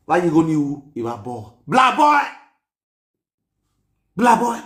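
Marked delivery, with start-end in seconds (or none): 1.7-4.6 put-on loud jeering call of blah boy; teasing yell; mock shout. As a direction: shout it out mockingly.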